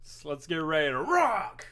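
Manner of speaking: loud, slow, high hype shout; Joe from Family Guy. Retold or imitated. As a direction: pump it up like Joe Swanson. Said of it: "we'll do Joe from Family Guy".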